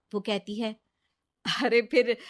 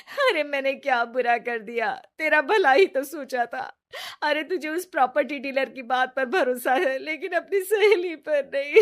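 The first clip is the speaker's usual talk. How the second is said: higher, tearful, words broken by sobs; crying while pleading; 5 semitones higher than usual. Acted. Caught cheating, Ritu sobs her excuses to Riya. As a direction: plead through put-on tears to win back trust. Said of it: "जोर जोर से रोने लगती है".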